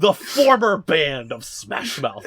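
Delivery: loud, slow, elevated declaration; grand proclamation. Retold or imitated. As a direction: proclaim it like you're holding them up on a pedestal. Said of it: "my left hand raised to the sky".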